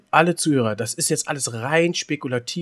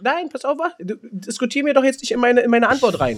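higher, louder snippy protest; 10 semitones higher, 3 dB louder than usual. Real, not acted. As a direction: cut the interrupter off, annoyed. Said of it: "Ich werd hier schon wieder zickig". (zickiger Protest, höher und lauter)

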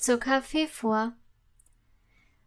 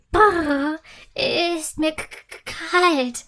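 higher, stuttering with cold; shivering; 4 semitones higher, 4 dB louder, slower than usual. A narrator's reading. Candy cane in the evening wind, shivering. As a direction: shiver out the words, catching on the k.

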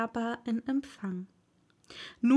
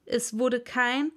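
ordinary delivery

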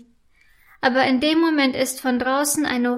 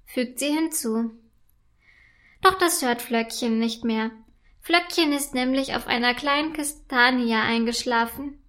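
ordinary delivery

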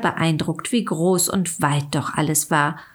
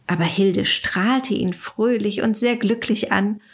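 ordinary delivery

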